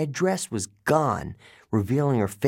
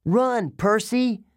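shouting; 10 semitones higher, slower than usual. A narrator's reading.

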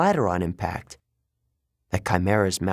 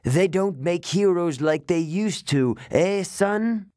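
higher, mocking monster mother's voice; cackling snake lady; 7 semitones higher, slower than usual. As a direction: taunt him with a cackle in her voice.

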